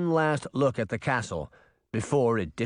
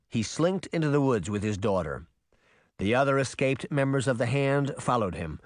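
ordinary delivery

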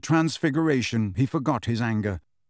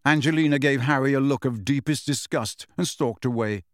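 plain reading voice

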